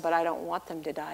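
soft, low, quick reply; subdued plea. Retold or imitated. Believer answering God from bed, a subdued, low plea. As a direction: plead quietly, all the fight gone.

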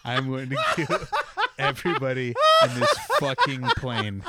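mock angry rant broken by laughter; laughing mock threat. Fed up with singing parents, a laughing mock threat.

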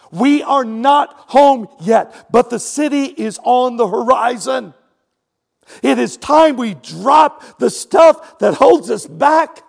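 loud, slow, rising sermon climax; preaching at full force. Acted. Preacher urging the congregation to drop their burdens, preaching loudly. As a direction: drive it home to the congregation.

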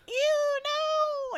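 very high mock-disgusted squeal; squeamish squeal. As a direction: recoil in exaggerated disgust. Imagined squeamish reaction.

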